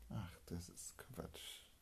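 voiceless dismissive whisper; slow, scoffing whisper. Acted. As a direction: hush it off as nonsense. Dismissive aside.

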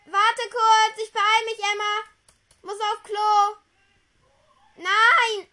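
high, loud call to another room. Real, not acted. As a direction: shout toward another room. Calling out to Emma.

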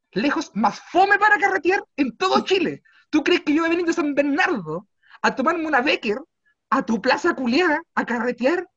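high, loud scornful tirade; mocking the dull plaza to the guard; mocking rant. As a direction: sneer it loudly at the guard. Ranting at guard about plaza.

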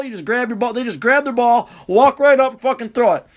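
ordinary talk, nothing marked

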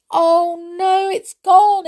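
high, loud wail near tears; girl wailing, close to tears; crying out in dismay. A narrator's reading. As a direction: cry out as the chair vanishes. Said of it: "cried Molly, almost in tears".

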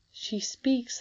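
put-on a little girl marvelling to herself; young Irene. A narrator's reading. Irene thinking about the old lady, light child voice.

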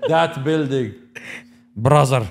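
put-on that building and Brother, high and loud with a Russian accent, through a laugh; laughing as you bark out a hammed-up Russian accent. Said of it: "that was Russian".

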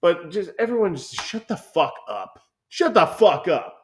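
fed-up shout at the critics; exasperated outburst. Real, not acted. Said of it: "exasperated rant".

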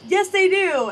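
high, loud insistent retort; imitating dad cutting in with a loud, high contradiction. Dad insisting to the receptionist, mock high loud retort.

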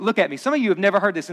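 normal talking voice

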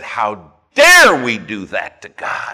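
loud, slow indignation, leaning on DARE; acted righteous outrage. Preacher quoting his pastor offended for God, outraged.

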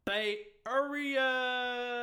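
drawn-out hype shout; hype call. Pretend. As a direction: stretch it out like a hype man. Hyping the Bay Area.

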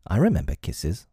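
usual speaking voice

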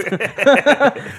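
high burst of laughter. Real, not acted.

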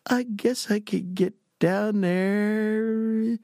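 high, drawn-out and halting; hesitant agreement. Retold or imitated. Young applicant accepting a job by phone, hesitant drawl. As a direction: stretch the words out as you stall.

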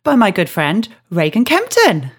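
ordinary delivery

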